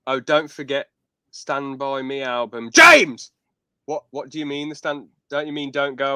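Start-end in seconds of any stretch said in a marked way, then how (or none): 2.7-3.3 sudden loud shout of the name, high then falling; calling out. Real, not acted. Host pulling up James in chat, sudden high shout.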